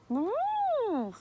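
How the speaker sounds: high, drawn-out hum; long skeptical storybook hum; pondering hum. Acted. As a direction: hum it high as if weighing someone up.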